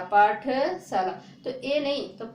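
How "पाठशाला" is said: पाठशाला stretched slowly and louder, syllable by syllable; slow demo. Shown as a demonstration. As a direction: spell out पाठशाला by ear, slowly. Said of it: "पाठ, पाठशाला नहीं".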